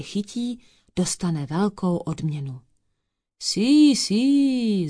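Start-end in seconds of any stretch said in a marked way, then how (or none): 3.4-4.9 high, loud Italian exclamation; exclaiming. A narrator's reading. Italian detective takes up the reward offer, exclaiming. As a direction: call it out eagerly, pitched up. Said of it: "zvolal Mazzanni".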